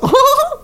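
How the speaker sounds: high-pitched mock throat-clearing cackle; exaggerated falsetto laugh mimicking a friend; Waleed impression. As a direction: burst of silly high cackling. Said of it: "That's how he clears his throat".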